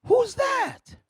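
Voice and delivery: high shouting schoolfriend. Retold or imitated. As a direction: push the friend's question way up. Chelsea asking about photos.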